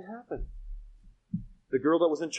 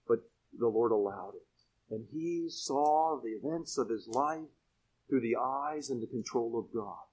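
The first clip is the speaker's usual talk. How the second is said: ordinary delivery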